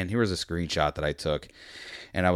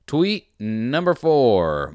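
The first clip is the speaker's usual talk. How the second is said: announcer read; 4 semitones higher, 4 dB louder, much slower than usual. Acted. Announcing tweets as a list.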